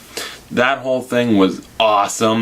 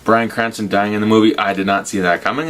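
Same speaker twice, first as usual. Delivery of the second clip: ordinary talk, nothing marked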